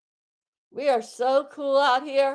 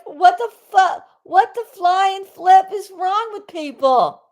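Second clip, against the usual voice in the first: higher, louder outburst of disbelief; exasperated yell; 6 semitones higher, 5 dB louder than usual. Real, not acted. Livestreamer fed up with people, yelling in disbelief. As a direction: let it burst out, fed up. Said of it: "Am I yelling?".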